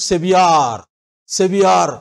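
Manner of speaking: సెవియార్ with a long stretched "yaar"; mock wrong pronunciation; student's wrong version. Shown as a demonstration. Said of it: "మీరు ఎలా పలికారు?".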